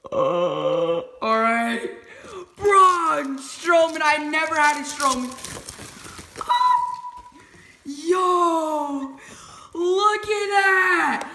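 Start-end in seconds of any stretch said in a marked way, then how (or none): 2.6-5.3 loud, slow, high shout of the name; real fan's roar; excited shout. Real, not acted. Unboxing dream figure. 6.4-11.3 high, loud, slow cry of joy; real overexcited fan; excited scream. Real, not acted. Screaming over figure.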